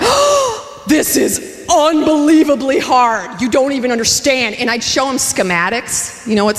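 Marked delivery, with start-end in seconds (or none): 0.0-4.5 high, slow drawn-out distress; anguished whine. Retold or imitated. Wife complaining to husband, high slow whine. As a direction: stretch unbelievably into a wail. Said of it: "And I went".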